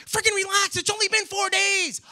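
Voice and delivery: loud, high exasperated outburst; retold shout of irritation; snapping. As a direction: snap at her at the top of your patience. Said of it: "And I snapped".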